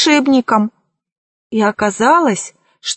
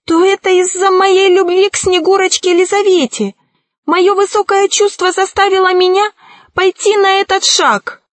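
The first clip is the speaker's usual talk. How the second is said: high, emotional confession; trembling voice; 8 semitones higher than usual. A narrator's reading. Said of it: "ответил колдун дрожащим голосом".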